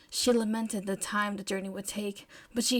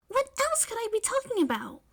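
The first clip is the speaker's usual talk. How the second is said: high May voice; 13 semitones higher, faster than usual. Acted. May's playful rhetorical question.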